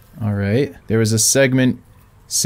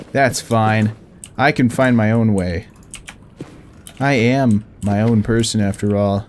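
usual speaking voice

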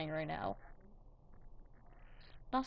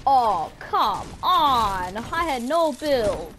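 frustrated groan; 3 semitones higher, 8 dB louder, much slower than usual. Real, not acted. Frustrated after death.

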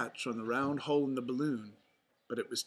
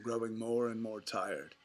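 ordinary delivery

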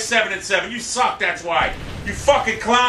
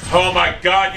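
ordinary talk, nothing marked

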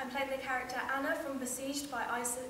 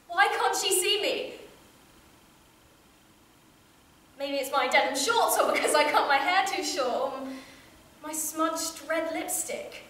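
loud, raised, urgent questioning; distressed pleading; 5 dB louder than usual. Acted. Child wondering why her mother can't see her, distressed. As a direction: grow desperate to be seen, voice pushed louder.

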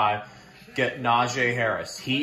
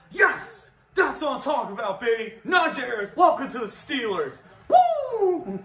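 far higher celebratory yells; real elated cheering; ecstatic shouting; 10 semitones higher than usual. Real, not acted. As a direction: fan celebrating the new running back, shouting. Welcomes the pick to the Steelers.

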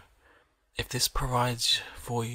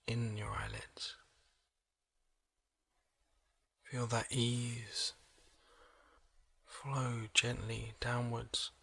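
soft, slow relaxation voice with long pauses; hushed, unhurried body-scan meditation; guided meditation; 6 dB quieter than usual. Acted. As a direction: gently guide the listener into calm, leaving space between phrases.

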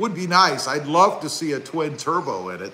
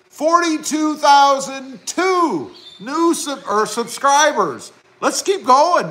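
excited milestone shout; 9 semitones higher, slower than usual. Real, not acted. Streamer announcing the new subscriber count to viewers, excited shout. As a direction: shout the number like a goal was scored.

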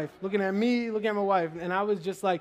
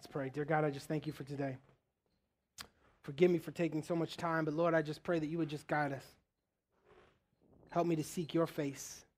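soft, low, hushed prayer; quiet prayer; 4 semitones lower, 8 dB quieter than usual. Acted. Pastor closing the sermon in prayer, soft and hushed. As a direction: bring the room into prayer gently.

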